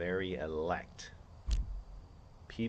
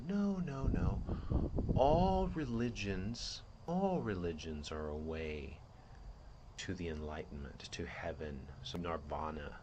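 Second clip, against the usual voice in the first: a seductive entity calmly waving objections away; smooth deceiver voice. Acted. Antichrist entity dismisses Christ's claim.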